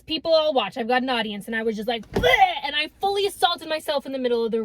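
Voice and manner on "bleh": loud hammed-up gag sound; mock gagging bleh; mock retch. Retching in the road.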